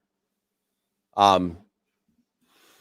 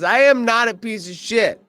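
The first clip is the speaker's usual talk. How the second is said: pitched up, offended protest; mock indignant Squeak; 9 semitones higher than usual. Squeak denying the insult to his friends, high and indignant. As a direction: whine it back, higher. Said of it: "Squeak Scolari says".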